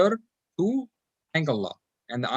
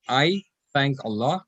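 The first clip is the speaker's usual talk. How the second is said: ordinary talk, nothing marked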